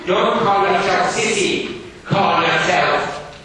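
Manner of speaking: loud, high, stern schoolmaster. Acted. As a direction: bark it like a strict Victorian teacher. Gradgrind scolding the girl.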